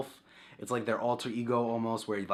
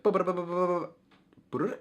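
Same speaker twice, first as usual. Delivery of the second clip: higher, slow game text babble; mock text beeps; 8 semitones higher, slower than usual. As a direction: chant the text scroll like a Game Boy. Imitating unreadable Japanese game text.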